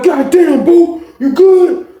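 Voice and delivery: high, loud, slow anxious call; worried calling out. Retold or imitated. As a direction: call to him, scared he's hurt. Kurt checks on hurt friend.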